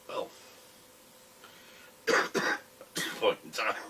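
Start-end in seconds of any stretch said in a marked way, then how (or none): 1.4-3.1 harsh hacking cough; smoker's cough. Real, not acted. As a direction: cough it out roughly. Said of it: "Excuse the cough, I smoke".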